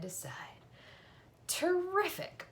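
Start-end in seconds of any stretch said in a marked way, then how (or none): 1.5-2.5 much higher, awed exclamation; joyful admiration. Acted. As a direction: marvel at the word in delight. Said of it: "breathed Zuckerman in joyful admiration".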